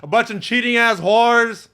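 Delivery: loud, high shouted punchline. Acted. Hyped recap.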